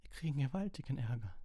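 high, dismayed wail; frightened whimper. A narrator's reading. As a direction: whine it in dread of punishment. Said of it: "wimmerte Hermine und starrte mit angsterfüllten Augen".

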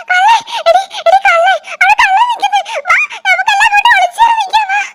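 high frightened shrieks of thief; child yelling for everyone to run from the thief; panicked screaming. Acted. Kids spot the thief, panic.

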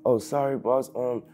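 high-pitched apologetic cop voice; flustered underling imitation. Officers to their angry boss, flustered imitated apology.

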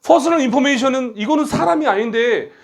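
high-pitched mock student protest; objecting student. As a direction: imitate a pupil pleading a grammar objection. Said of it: "그렇게 공부하지 말라고 그랬지".

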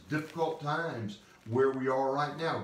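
usual speaking voice

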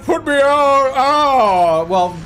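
high, loud, desperate yell; loud pleading yell. Real, not acted. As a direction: beg at the top of your voice. Frantic gaming outburst.

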